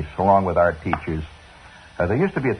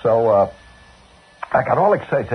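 usual speaking voice

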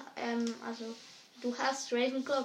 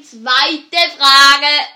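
much louder and slow, word by word; loud, slow call; 18 dB louder than usual. Real, not acted. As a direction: shout it slowly so he hears over music. Partner wears loud headphones.

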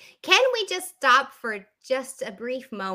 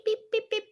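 mock censor beep; 11 semitones higher, 3 dB quieter than usual. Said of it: "let me just beep".

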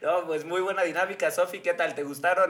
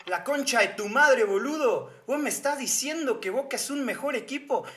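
heated, mock-Argentine football rant with swooping tune; Argentine accent, quarrelling. Arguing with a Boca-fan friend, angry Argentine accent. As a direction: play an indignant River fan in Argentine accent. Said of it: "fingir entonces que soy de ustedes".

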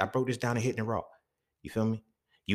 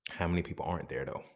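ordinary talk, nothing marked

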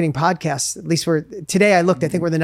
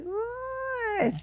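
a sudden squeaky "what", much higher and near-breathless; mock disbelief; 13 dB quieter than usual. Telling a friend he won't drive the kids, a shrill stunned what. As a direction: act floored by what he just heard.

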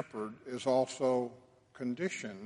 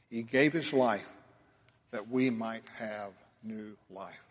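ordinary talk, nothing marked

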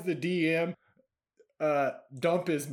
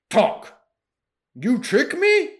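angry troll; 8 semitones higher, 9 dB louder, slower than usual. Acted. Dump angry demand.

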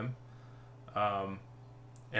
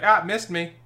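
playful, higher and louder jeer; mock taunt; 7 semitones higher, 6 dB louder than usual. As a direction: call out a cheeky imagined taunt. Imagined taunt from inside enemy.

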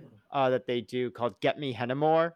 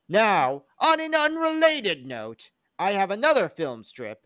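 pompous boss; 4 semitones higher, 6 dB louder than usual. Retold or imitated. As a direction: lecture the dim employee with mock gravity. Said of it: "and his boss goes".

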